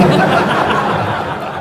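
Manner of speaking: loud high laugh; real amused laugh; high burst of laughter. Real, not acted. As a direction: laugh out at the irony. Laughing at opponents.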